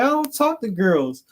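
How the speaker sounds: imitating her high Southern accent; high-pitched Southern woman impression. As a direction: repeat the brush-off in a high mock Southern voice. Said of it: "she had that Southern accent".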